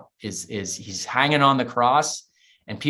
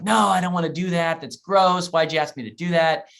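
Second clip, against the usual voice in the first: put-on higher, louder, kid whining in protest; pitching a fit; 6 semitones higher, 3 dB louder than usual. Boy to his mom about the porta potty, whiny protest. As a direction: throw a bratty little tantrum. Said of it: "I just pitched a fit".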